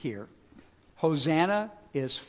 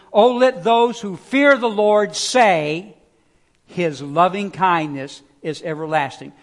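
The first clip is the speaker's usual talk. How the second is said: psalm reading; 6 dB louder than usual. Acted. Reads psalm aloud, preaching.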